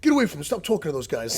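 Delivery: high, loud imitation of a jealous drunk; hammed-up shouted order to a girlfriend; mock angry yell. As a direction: yell it as a raging boyfriend. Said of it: "he's yelling at his girlfriend".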